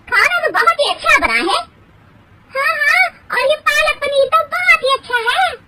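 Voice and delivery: high, loud, chirpy guest; guest voices. Acted. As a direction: gush over the food. Guests praise the food.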